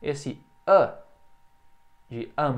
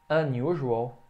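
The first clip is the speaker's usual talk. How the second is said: normal talking voice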